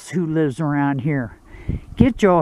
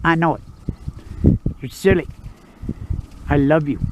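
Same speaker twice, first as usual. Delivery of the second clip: tender; 4 dB quieter than usual. Real, not acted. Owner softly telling his dog he loves her. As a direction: murmur it to the dog.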